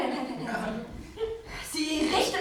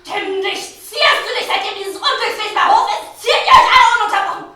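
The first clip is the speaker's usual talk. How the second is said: much higher and much louder, shouting; furious shrill scolding; 7 semitones higher, 16 dB louder than usual. Acted. Queen berates her lady-in-waiting for being coy, in a furious high shout. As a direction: explode at her, half laughing with rage.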